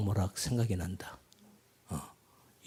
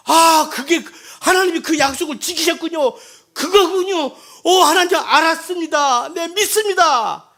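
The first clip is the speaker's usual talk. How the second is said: high, loud, laughing delight, slowing at the end; joyful realization; 13 dB louder than usual. Acted. Believer suddenly grasping God's promise, laughing with joy. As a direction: burst into happy laughter of discovery.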